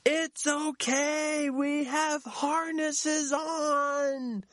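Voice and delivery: calm the panic gently; high, slow reassurance; reassuring inner voice. Retold or imitated. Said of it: "another part of my brain goes".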